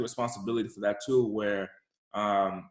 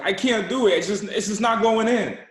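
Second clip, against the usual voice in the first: put-on higher, louder kid's protest; protesting child; 9 semitones higher, 6 dB louder than usual. Struggling kid to driver over seatbelt, protesting. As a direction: imitate a child insisting he can't. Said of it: "this is what he does".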